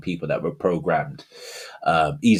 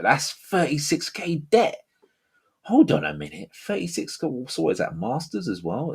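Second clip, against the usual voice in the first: surprised; 10 semitones higher than usual. Real, not acted. Shocked at the debt figure.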